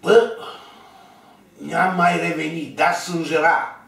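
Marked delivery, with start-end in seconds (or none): none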